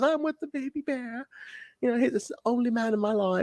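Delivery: high-pitched mock British lady voice; imitating Goldilocks. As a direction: put on an airy, high British character voice. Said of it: "Goldie's talking in a British accent".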